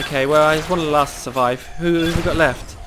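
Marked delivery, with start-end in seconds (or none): none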